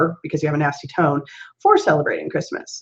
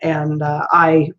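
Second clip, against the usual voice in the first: ordinary delivery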